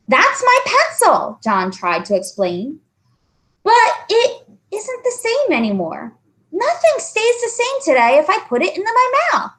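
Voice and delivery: high, earnest schoolboy voice; anxious boy explaining. Acted. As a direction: try hard to make her believe. John explaining.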